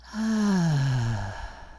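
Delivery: long falling exhaled "ahh" sigh; gentle sigh. Shown as a demonstration. Guide showing how to exhale through the mouth, gentle sigh. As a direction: let the breath out in an open, releasing sigh. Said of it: "exhale softly through your mouth with a gentle sigh".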